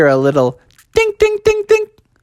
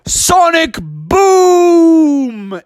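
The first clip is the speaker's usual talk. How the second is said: higher, louder, drawn-out yell; yelled battle cry; 12 semitones higher, 5 dB louder, much slower than usual. Acted. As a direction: shout the special move. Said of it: "Froggy yelled out".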